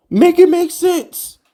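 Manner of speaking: fed up with the ex-GM, whining high and slow; exasperated plea. Real, not acted. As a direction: drag it out in a high incredulous whine.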